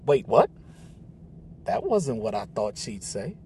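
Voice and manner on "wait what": startled loud question; surprised. A narrator's reading. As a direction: blurt it out in disbelief. Caught off guard by Mom.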